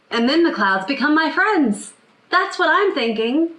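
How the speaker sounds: put-on four-year-old son. Son telling mom his daydream about clouds, high child voice. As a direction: play a little kid sharing a daydream. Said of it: "That's what my four-year-old son was thinking".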